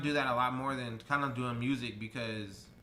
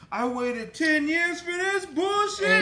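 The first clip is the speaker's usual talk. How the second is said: high, drawn-out mock outraged shout; hammed-up yell of complaint, pitched way up; outraged fans; 8 dB louder, much slower than usual. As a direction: holler it slowly like a let-down crowd. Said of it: "everybody's gonna be like".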